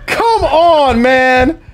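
high exasperated yell; frustrated shout. Real, not acted. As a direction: let the frustration burst out loud. Frustrated after dying in game.

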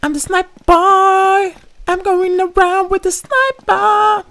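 very high, slow cartoon voice; high slow mock boast; silly squeaky voice.

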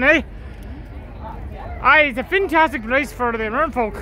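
ordinary talk, nothing marked

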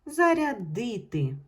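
teacher voicing the д in "Зарядити"; clear loud check word. Shown as a demonstration. Teacher to pupils, loudly sounding the check word. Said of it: "чітко чути".

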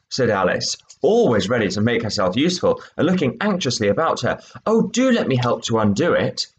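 lively read-aloud; story reading. A narrator's reading. Teacher reading the mouse and Alice scene, expressive. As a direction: voice the scene for the class. Teacher reads third extract.

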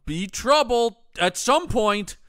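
hammed-up bad-guy warning; mock villain threat.

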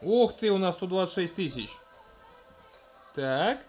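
ordinary talk, nothing marked

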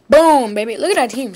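loud triumphant cheer; excited shout. Real, not acted. Gamer cheering a strong team. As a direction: celebrate the win loudly.

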